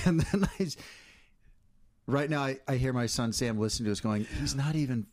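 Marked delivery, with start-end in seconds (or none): none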